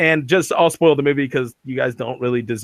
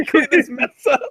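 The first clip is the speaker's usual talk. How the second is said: squeaky mock order; 13 semitones higher than usual.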